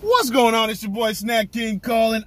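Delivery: hype greeting. Real, not acted. Vlogger greeting viewers loudly.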